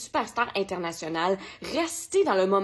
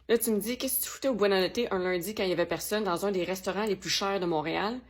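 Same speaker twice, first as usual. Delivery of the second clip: usual speaking voice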